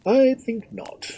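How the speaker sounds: high, slow, self-important lord; puffed-up lordly dismissal; pompous. A narrator's reading. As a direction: draw it out loftily in a high voice. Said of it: "said Lord Gustavus pompously".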